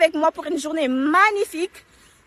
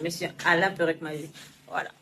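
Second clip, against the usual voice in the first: deep character voice; 9 semitones lower, 8 dB quieter, faster than usual. Acted. Announces wig artist character.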